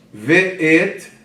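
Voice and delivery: Ve'et in two loud, separate syllables; syllable division. Shown as a demonstration. Teacher showing syllable division, Ve'et said in two parts. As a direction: split Ve'et clearly into ve and et. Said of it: "Olha a divisão silábica".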